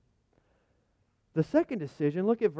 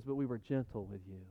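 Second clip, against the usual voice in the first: much softer and lower, tenderly; hushed gentle reading; 6 semitones lower, 9 dB quieter than usual. Retold or imitated. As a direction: ease right down as if to a child. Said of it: "But then he says".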